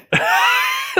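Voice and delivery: short high-pitched laugh; squeaky amused laugh. Real, not acted. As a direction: let out a high little laugh. Laugh after "but man.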